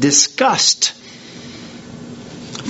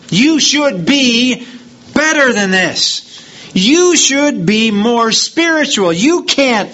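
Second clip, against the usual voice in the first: higher, slow, reproachful voice; judgmental accuser; 8 semitones higher, 3 dB louder, slower than usual. Retold or imitated. Preacher voicing a believer scolding a brother, reproachful. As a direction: lay the guilt on them, slowly and pointedly.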